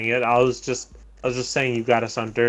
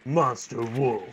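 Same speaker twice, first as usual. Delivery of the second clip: monster voice; 5 dB quieter, much slower than usual. Pretend. Echoing monster voice.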